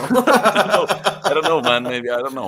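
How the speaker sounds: giggling through the words; laughing. Real, not acted. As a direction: laugh while you answer. Laughing at worm question.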